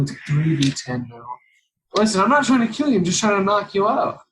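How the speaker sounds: ordinary delivery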